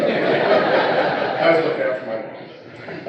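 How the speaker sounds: slow words, laughing all through; real laughter over the punchline. Real, not acted. Son telling the memorial crowd a punchline, laughing.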